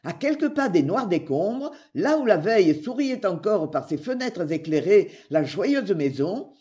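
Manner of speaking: ordinary delivery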